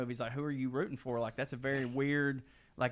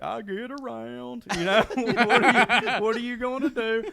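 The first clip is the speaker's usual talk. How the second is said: higher, laughing lazy shrug of a voice; imitating Wade; 8 semitones higher, 8 dB louder than usual. Imitating Wade shrugging off the complaint, higher and laughing. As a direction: play the sleazy guy brushing it off with a chuckle. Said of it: "he was just like".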